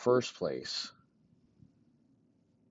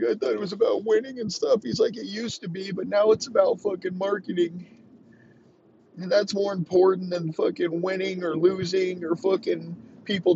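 much higher put-on voice, ranting; high mock friend voice; 10 semitones higher than usual. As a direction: lecture Tom in a silly squeaky voice. Voicing Edelman's rant on phone.

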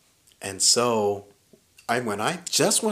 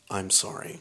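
low, soft retold apology; quiet apology; 5 semitones lower, 7 dB quieter than usual. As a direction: imitate a small, subdued sorry. Girlfriend's apology after the fight, retold.

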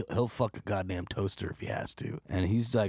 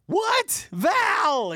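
high, loud desperate scream "What? Val!"; screaming for his girl; 7 dB louder than usual. Retold or imitated. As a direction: shriek her name in panic. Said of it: "he's screaming for his girl".

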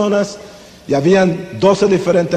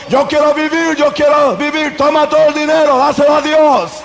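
mock panicked pleading; 7 semitones higher than usual. Frightened churchgoers begging the gang, hammed-up panicked cries. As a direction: beg for your life, hammed up.

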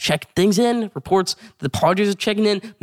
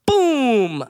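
higher, punchy "Boom!"; excited home run call; 7 semitones higher than usual. Retold or imitated. Retelling Judge's homer to viewers, excited call. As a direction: call it like the ball just left the park.

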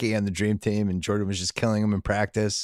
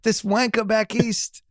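put-on high, slow jokey British accent; mock British accent; 10 semitones higher, slower than usual. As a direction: sneer it in a hammed-up British accent. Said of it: "We're doing some sort of British thing".

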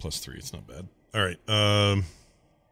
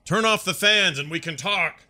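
yelling up; 10 semitones higher than usual. Acted. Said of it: "I yell from my hole".

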